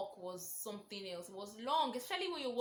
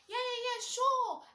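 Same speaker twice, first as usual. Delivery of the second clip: quick high eager yeses, much higher and louder; 7 dB louder, slower than usual. Retold or imitated. Accepting her friend's movie invite, eager and high. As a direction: jump at the invitation.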